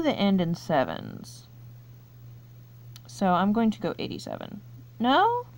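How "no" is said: high, incredulous "No?"; can't believe you got it wrong; surprised disbelief. Real, not acted. Quiz player learning the answer was wrong, high disbelief.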